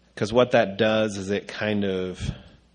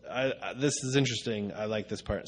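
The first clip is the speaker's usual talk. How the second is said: normal talking voice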